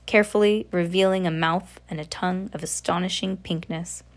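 usual speaking voice

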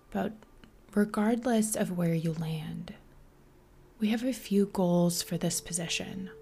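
soft, whispery meditation guide with long pauses; guided meditation. Acted. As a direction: ease the listener into stillness, softly.

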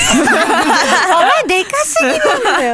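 high, laughing jab at a friend; laughing tease. Real, not acted. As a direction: laugh it out while teasing. Teasing the loud member.

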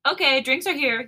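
loudly getting everyone's attention; loud announcement. A narrator's reading. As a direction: announce it to the whole room. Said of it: "Yeonjun says loudly".

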